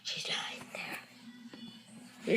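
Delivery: soft unvoiced whisper. Real, not acted. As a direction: whisper so the dog stays asleep. Peeking at sleeping dog.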